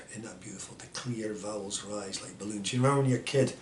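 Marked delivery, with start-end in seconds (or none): 0.8-2.7 soft, low repeat of a verse; hushed poetry recitation. Acted. Repeating a beautiful line to a listener, hushed recitation. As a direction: savour it quietly, almost to yourself.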